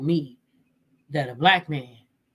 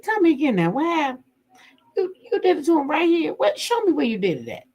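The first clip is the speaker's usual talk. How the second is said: high, faster questioning voice; mimicking calm wife; 10 semitones higher, faster than usual. Vlogger voicing the wife grilling the mistress, high and quick. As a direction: pitch it up high and press her for details. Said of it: "She said".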